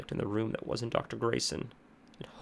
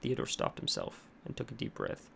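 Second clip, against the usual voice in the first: plain reading voice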